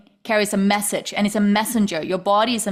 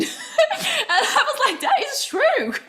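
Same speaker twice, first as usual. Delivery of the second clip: laughing. Real, not acted. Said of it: "laughing while retelling".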